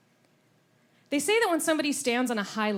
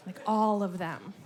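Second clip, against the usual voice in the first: normal talking voice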